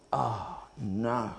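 low, soft sinking groan; dismay. Retold or imitated. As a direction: let it sink, quiet and deflated, in mock dismay. Acted dismay on seeing the food.

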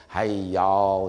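low Deep South drawl impression; Southern US accent. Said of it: "they kind of talk funny there".

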